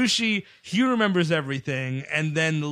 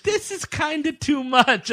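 whiny character voice; 9 semitones higher, slower than usual. Retold or imitated. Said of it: "even Frank Whaley's like".

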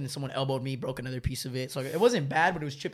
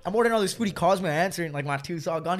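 ordinary talk, nothing marked

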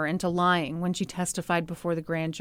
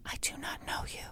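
imitating a scared whisper, barely audible; mock whisper; 17 dB quieter than usual. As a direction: breathe it out like a frightened witness. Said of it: "he's talking to her in a normal voice, and she's saying".

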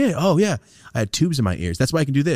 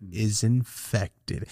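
very slow, stretched-out mock diagnosis; imitated doctor's verdict; 2 semitones higher, 4 dB quieter, much slower than usual. Patient re-enacting doctor's diagnosis, slow mock verdict. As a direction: draw each syllable out, imitating the doctor. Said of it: "That's how he said it".

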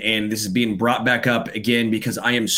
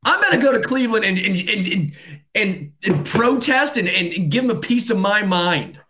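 much higher, yelled outrage; shouting rant; 8 semitones higher than usual. Real, not acted. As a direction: let the anger boil over, shouting. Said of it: "can you stop shouting".

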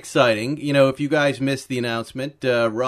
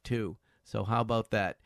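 ordinary talk, nothing marked

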